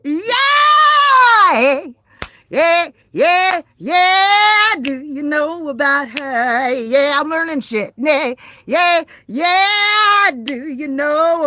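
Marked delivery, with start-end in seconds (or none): 0.0-4.7 high, loud cheer; whooping yeah. Real, not acted. Cheering when stream comes back. 8.0-10.3 cheering. Real, not acted. Cheering again.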